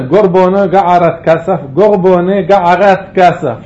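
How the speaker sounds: loud throaty R in גורבונה and גערת; גורבונה with guttural rustle; rustling resh. Shown as a demonstration. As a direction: rasp the resh of גערת at the throat instead of the tongue. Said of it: "הרשרוש הזה".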